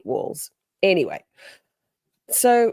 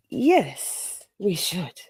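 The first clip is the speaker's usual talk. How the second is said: reluctant agreement; much slower than usual. Retold or imitated. Reluctant agreement to find owner.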